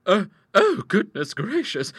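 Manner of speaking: high, loud flustered fright; frightened stammer. Acted. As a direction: stammer in rising panic. Sisters spot a snake.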